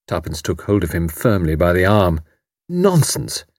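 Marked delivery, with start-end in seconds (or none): 2.7-3.4 brisk, higher, firm dismissal; robustly. A narrator's reading. Tuppence gripping his arm, robust. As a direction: snap him out of it. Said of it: "she said robustly".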